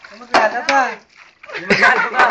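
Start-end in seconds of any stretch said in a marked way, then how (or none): 1.5-2.3 laughing while saying it. Real, not acted. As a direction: say it through a laugh. Laughing repeat.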